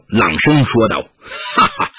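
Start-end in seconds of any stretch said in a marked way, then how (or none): 1.6-1.9 a weird sneering cackle; strange laugh. A narrator's reading. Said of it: "蓦然怪笑".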